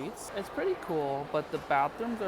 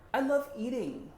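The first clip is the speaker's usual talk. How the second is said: gushing; 11 semitones higher, 3 dB louder than usual. Real, not acted. Gushing about food.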